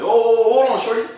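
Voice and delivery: high, loud startled protest. Retold or imitated. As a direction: pull back sharply, high and loud. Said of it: "I'm like".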